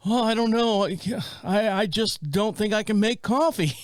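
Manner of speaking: a light whiny dad voice; helpless old dad. Retold or imitated. Son mimicking his father admitting he can't make coffee, meekly.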